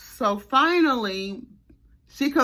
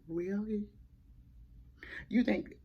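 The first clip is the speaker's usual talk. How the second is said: silently mouthed words, far softer; mouthing; 11 dB quieter than usual. Retold or imitated. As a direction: mouth it without voice at her mom. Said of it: "and mouthing".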